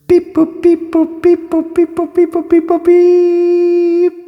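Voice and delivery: very high-pitched robotic beeps; monotone high beep-boops, last one held long; playful beep-boop noises. Pretend. Filling time while choosing the next question, playful beeping.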